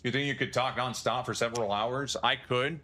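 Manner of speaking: normal talking voice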